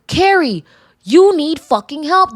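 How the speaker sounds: high, loud and slow exasperated scolding; scolding the character. Acted. Viewer scolding Carrie on screen, exasperated and loud. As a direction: tell her off like a fed-up friend. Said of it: "looking at the character saying".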